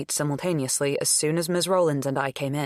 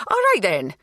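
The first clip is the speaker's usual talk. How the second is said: louder, brisk "All right then" falling on "right"; 4 semitones higher, 7 dB louder than usual. A narrator's reading. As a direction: wrap it up briskly. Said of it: "said Miss Rowlands".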